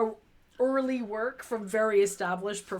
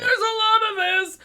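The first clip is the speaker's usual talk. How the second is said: mock eager voice; 10 semitones higher, 8 dB louder than usual. Voicing the Podchaser founders.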